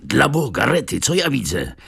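high startled outcry; alarmed shout; sudden cry. A narrator's reading. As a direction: cry out in alarm at what he sees. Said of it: "krzyknął nagle wielkim głosem".

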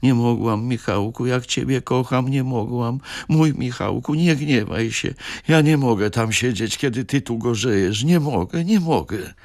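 high, imploring wife begging forgiveness; tearful wife begging her husband; pleading. A narrator's reading. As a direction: plead like a child fearing punishment. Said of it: "wołać łukającym głosem".

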